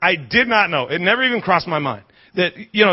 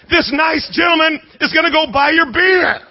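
imitated high, loud holler; shouted announcement; 10 semitones higher, 5 dB louder than usual. Wife announcing to husband who buys beer, shouting. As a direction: belt it out like the wife.

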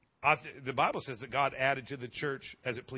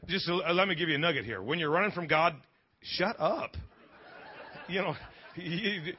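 ordinary delivery

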